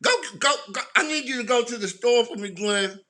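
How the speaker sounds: imitating serious grandma. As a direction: send Glenn to the store firmly. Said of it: "she would switch gears".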